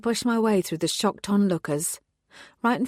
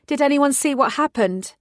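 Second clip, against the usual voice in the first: raised voice to the crowd; detective calling out to the onlookers, loud; 7 semitones higher, 5 dB louder than usual. Acted. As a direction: call out to the whole crowd, high and loud.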